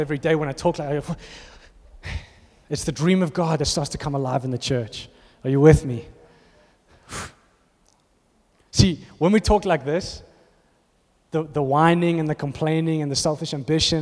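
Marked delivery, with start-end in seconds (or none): none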